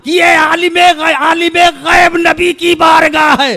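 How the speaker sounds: high, loud preacher's shout; impassioned sermon shout; fervent preaching. Acted. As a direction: cry it out to the congregation at full force.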